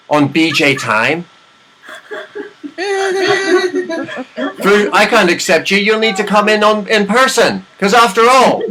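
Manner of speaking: mock Chinese accent building to a big finish. Recruiter demands in-person interview in a Chinese accent. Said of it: "that Chinese accent, though, pretty good".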